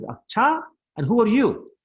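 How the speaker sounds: high, loud mock-incredulous retort; angry challenge. Mother turning on Balram after his claim, indignant. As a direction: fire the question back, acting indignant. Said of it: "was just even more angry".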